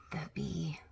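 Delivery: scared whisper. Pretend. Teacher whispering about the bee to the children. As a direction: breathe it out like it might hear you.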